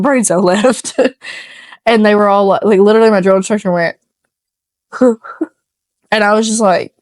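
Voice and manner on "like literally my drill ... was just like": usual speaking voice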